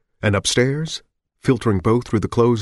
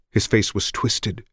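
ordinary delivery, nothing marked

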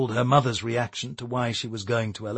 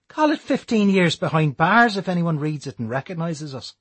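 sharp retort; 6 semitones higher, 5 dB louder than usual. A narrator's reading. Declan's reply, voiced.

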